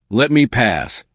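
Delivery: ordinary delivery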